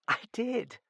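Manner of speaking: put-on high, small girlish voice; little laughing voice. A narrator's reading. Girl owning up to Sir John, lightly amused. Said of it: "a little voice confirmed him, laughing".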